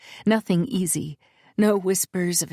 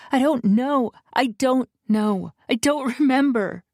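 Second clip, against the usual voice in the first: higher, pleading, tearful; a touch of tears, pitched up; mild sobbing plea; 6 semitones higher than usual. A narrator's reading. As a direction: heroine near tears, pleading. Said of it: "I'm sobbing, crying".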